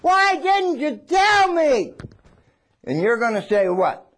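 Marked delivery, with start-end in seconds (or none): 0.0-2.0 high, slow wail from the pit; a mock anguished yell up from below; anguished cry. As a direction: cry out betrayed, pleading. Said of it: "he's yelling up to you".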